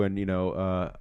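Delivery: usual speaking voice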